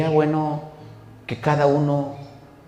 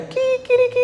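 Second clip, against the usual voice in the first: very high crowing cock-a-doodle-doo; rooster crow. Retold or imitated. Preacher imitating the rooster after the third denial, high crow. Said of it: "el gallo".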